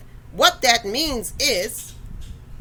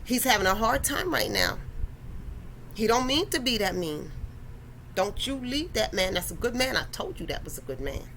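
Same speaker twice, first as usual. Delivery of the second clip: granny voice; 4 semitones lower, 8 dB quieter than usual. Retold or imitated. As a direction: gently talk her out of leaving, like a granny. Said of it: "you can hear your granny voice telling you".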